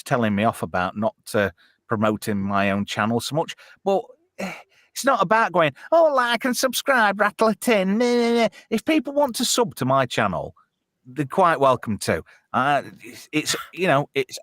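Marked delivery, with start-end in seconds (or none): 5.9-8.5 high-pitched mock begging for likes; mocking plea. Streamer mocking channel plugs he refuses to make, high mocking plea. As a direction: parody a nagging self-promoter, pitched well up.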